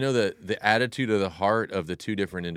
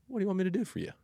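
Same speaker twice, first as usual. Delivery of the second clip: soft and higher, almost hushed; gentle, open-hearted offer; 11 semitones higher, 5 dB quieter, much faster than usual. Acted. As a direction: ask tenderly, as if nothing else mattered. Jesus setting noise aside, offering help.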